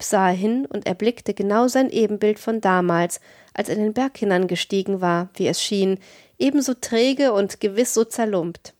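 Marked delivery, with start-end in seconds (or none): none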